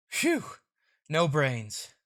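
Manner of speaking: ordinary delivery, nothing marked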